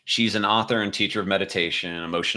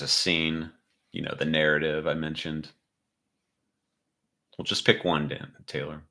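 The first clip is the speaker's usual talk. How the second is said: guided meditation. Acted.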